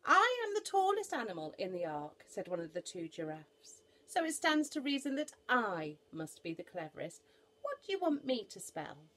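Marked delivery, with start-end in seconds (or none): 0.1-2.2 high, loud, lofty voice; pitched-up, self-important animal; boastful giraffe. Acted. 4.1-9.1 high, loud, lofty voice; pitched-up, confident animal; boastful giraffe. Acted.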